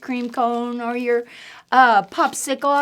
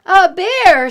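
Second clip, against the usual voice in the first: loud, higher exclamation of a mother; amused mom; 10 semitones higher, 10 dB louder than usual. Acted. Mom repeating her son's worry, loud. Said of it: "said Mom".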